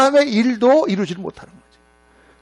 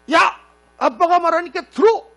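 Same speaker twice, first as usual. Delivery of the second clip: sharp, much higher mock yell at the kid; dad blowing up; 11 semitones higher than usual. Father losing patience with sassing teen, sharp high yell. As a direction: snap at the back-talking teen, high and hard. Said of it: "확 하면서".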